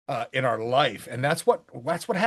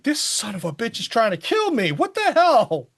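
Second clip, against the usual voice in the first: rising mock panic, louder at the end; exasperated outburst; 9 semitones higher, 5 dB louder than usual. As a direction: play the frantic writer losing it. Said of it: "you're like".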